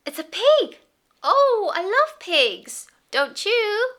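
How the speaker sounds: put-on high, loud, singsong toddler talk; reading to a toddler. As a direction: beam at a little child and coo. Talks to toddlers, animal sounds.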